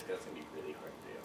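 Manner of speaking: ordinary talk, nothing marked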